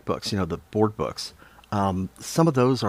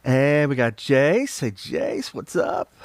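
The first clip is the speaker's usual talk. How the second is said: ordinary talk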